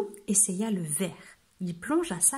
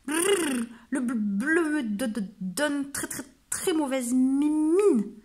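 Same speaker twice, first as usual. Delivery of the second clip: shivering, stuttering, higher voice; teeth chattering; 4 semitones higher than usual. Acted. Frozen wolf shivering at the mirror, teeth chattering. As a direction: stammer through chattering teeth. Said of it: "En claquant des dents".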